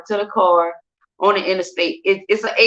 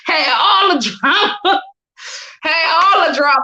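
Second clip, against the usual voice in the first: higher-pitched, through laughter; laughing admission; 7 semitones higher than usual. Real, not acted. Admitting she lied about having no drama, laughing. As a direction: laugh while owning up to it.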